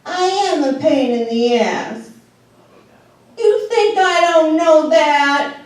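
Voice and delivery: a hint of a sorry old mother; lightly voiced mother owning up; mother admitting. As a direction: confess it a bit slowly.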